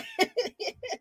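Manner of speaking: a squeaky high giggle; real giggle fit; high squealing laugh. Real, not acted. Laughing about Sonya laughing.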